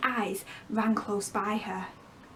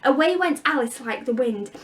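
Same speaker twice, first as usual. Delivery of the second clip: plain reading voice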